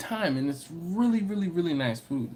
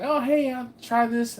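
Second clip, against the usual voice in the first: eager try; 8 semitones higher than usual. Retold or imitated. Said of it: "When you're like".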